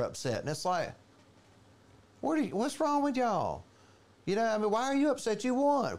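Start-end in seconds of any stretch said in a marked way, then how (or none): 2.2-6.0 high incredulous voice; incredulous mock. Preacher mocking upset voters, high and incredulous. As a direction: squeak it out in disbelief.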